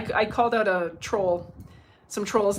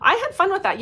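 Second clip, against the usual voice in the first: excited; 10 semitones higher, 6 dB louder than usual. Real, not acted. Gleeful about troll story.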